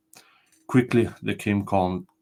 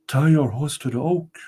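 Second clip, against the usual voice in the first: higher, solemn ghostly spirit; castle spirit; 5 semitones higher than usual. Acted. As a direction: announce yourself as the ancient spirit.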